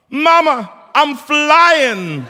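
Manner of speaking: high, loud joyful yell; shouted out. Retold or imitated. Singer yells to the audience from sixty feet up, high and loud. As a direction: belt it out from way up high. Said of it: "I shouted out from sixty feet above".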